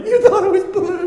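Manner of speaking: high, loud squeal through mock laughter; wife laughing helplessly. Husband imitating wife remembering his mistake, high laughing squeal. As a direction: squeal it as if cracking up. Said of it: "she was laughing so hard".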